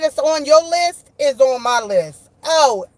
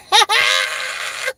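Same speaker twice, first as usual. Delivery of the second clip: high shrieking laugh; 12 semitones higher than usual. Real, not acted. Laughs at the named crew.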